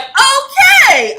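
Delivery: loud, very high drawn-out okay; startled outburst. Retold or imitated. Host reacting to a shocking scene, startled outburst. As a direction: exclaim it high and loud in mock alarm.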